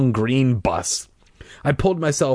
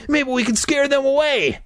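high frantic cry; frantic shout. Acted. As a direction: shout it in a panic. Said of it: "he was shouting frantically".

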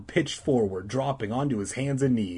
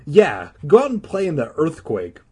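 louder, higher dismissive retort; snapping; 5 semitones higher, 7 dB louder than usual. Acted. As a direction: snap at a pesky brother. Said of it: "Regina snapped".

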